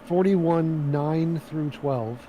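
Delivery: slow, careful restating of numbers; slow repeat. Real, not acted. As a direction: spell the numbers out slowly. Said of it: "Can you repeat".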